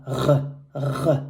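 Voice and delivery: r r as a throaty French R in the back of the throat; isolated French uvular r; French R demo. Shown as a demonstration. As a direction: growl out the French r on its own. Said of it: "The final R is pronounced like r".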